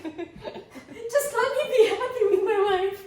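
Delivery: slow, high, laughing mock self-pity; mock wounded plea. Host mock-pleading with her guest, slow and laughing. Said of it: "I'm just kidding".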